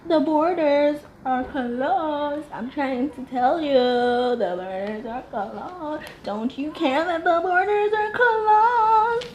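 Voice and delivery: high, slow whiny mimicry, last line sung; mocking her voice. Mimicking the wife insisting the borders are closed, high whiny singsong. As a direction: drag it out, end in a singsong. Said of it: "I just can't".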